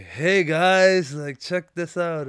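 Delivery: loud, high influencer greeting, drawn out; social media voice. Acted. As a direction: put on a peppy Instagram video voice. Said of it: "social media voice".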